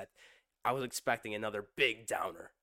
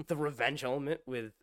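ordinary delivery

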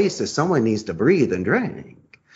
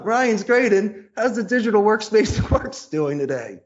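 higher, eager and laughing through the greeting; eager pitch opener; 10 semitones higher than usual. Shown as a demonstration. Salesman pitching the boss with a bright, laughing greeting. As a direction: sell it with a laugh and a lift. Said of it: "I could double gun him".

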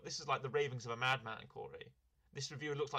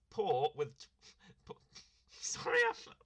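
giggling through the words; cracking up mid-read; breaking character giggling; 3 semitones higher, 3 dB louder than usual. Real, not acted. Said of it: "I'm laughing already".

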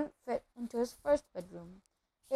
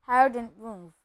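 plain reading voice

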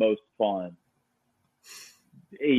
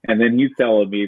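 normal talking voice

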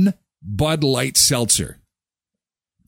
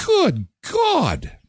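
high, drawn-out exclamation; mock exasperation; 12 semitones higher than usual. Host reacting to Super Bowl prices, mock exasperated. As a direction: toss it up in disbelief.

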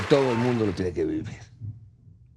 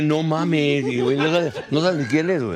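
higher, louder mock "no mames"; mock disbelief; 6 semitones higher, 4 dB louder than usual. Retelling a friend's reaction, louder and high.

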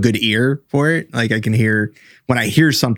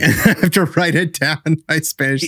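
laughing through the words, a bit higher; a sheepish laugh while admitting it; giggling out the confession; 5 semitones higher than usual. Real, not acted. Admitting his written Spanish is weak.

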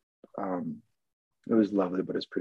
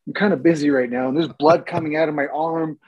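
louder, higher, half-laughing complaint; patient telling nurses he's bleeding, exasperated and louder; exasperated protest; 6 semitones higher, 8 dB louder than usual. Retold or imitated. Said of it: "I'm like".

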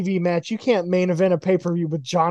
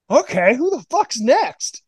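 higher and slower, a strutting dare; hammed-up swagger; mock cocky challenge; 5 semitones higher than usual. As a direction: call out the whole locker room like you own it. Silver feeling himself backstage.

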